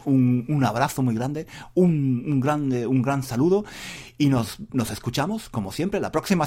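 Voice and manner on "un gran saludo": ordinary talk, nothing marked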